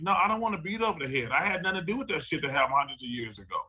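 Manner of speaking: a hurried, fed-up normal person; fast normal person voice. Retold or imitated. Normal person brushing off the lecture, fast and fed up. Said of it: "a normal person gonna be like".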